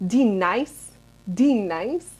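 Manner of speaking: drawn-out sketch-comedy mispronounced name; Key and Peele. Retold or imitated. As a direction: imitate the clip, punching "D nice". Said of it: "sounds like that clip of".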